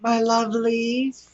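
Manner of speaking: sugary sweet and unhurried; cooing to fans. Pretend. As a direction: coo slowly at your adoring listeners. Sweet address to the listeners.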